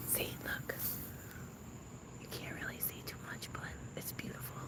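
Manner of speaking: hushed whisper so no one hears. Real, not acted. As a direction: whisper it secretly. Whispering while filming neighbor.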